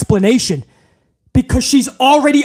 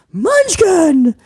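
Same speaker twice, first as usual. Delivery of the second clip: high again; 6 semitones higher than usual. Pretend. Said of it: "put some bass in your voice".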